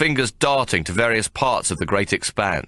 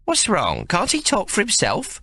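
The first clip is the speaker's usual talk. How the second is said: blunt; 12 semitones higher than usual. Acted. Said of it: "Mary asks bluntly".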